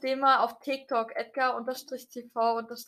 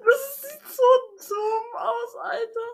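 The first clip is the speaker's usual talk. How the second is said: laughing; 13 semitones higher, 4 dB louder than usual. Real, not acted. Laughing at silly play.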